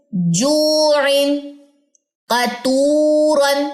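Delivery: جوعن and قطورن with the long vowel held for two seconds. Shown as a demonstration. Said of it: "دو second آواز لمبی ہونی چاہیے". (دو second تک آواز لمبی کرنا)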